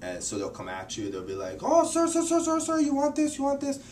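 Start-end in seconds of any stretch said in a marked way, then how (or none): 1.6-3.8 very high, rapid-fire imitation of a pushy seller; aggressive street vendor. Market vendor badgering a presumed wealthy tourist, imitated pushy pleading. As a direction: pester like a hawker, loud and high. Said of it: "It might seem aggressive".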